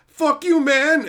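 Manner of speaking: high, loud mock outburst; pissed off. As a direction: imitate an angry player blowing up. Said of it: "got all pissed off".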